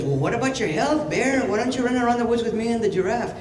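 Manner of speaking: high-pitched rabbit character; coaxing rabbit. Acted. Rabbit coaxing the bear to run along, high-voiced. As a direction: lift it high and plead lightly.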